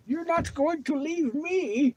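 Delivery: squeaky, high, slow voice for the dog; voicing the dog. Owner voicing the blind dog who refuses to be left. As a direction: speak as the clingy pup, whiny and high. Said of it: "He's convinced".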